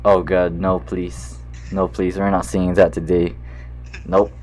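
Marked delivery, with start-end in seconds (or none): none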